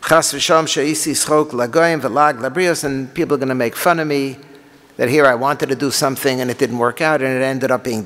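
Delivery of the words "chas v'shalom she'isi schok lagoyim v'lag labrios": usual speaking voice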